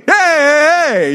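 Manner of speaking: high teasing "hey, hey" through a laugh; mock schoolyard taunt. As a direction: jeer at a kid who got too close.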